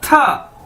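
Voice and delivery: था modelled high for learners; Chinese tā. Shown as a demonstration. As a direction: say था high and even, twice. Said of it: "इसको कैसे बोलना है".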